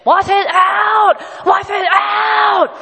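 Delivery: high, loud, slow screamed chant; strong-willed toddler defying her mom, loud high scream. Retold or imitated. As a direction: scream it like a defiant two-year-old.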